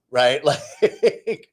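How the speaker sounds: ordinary delivery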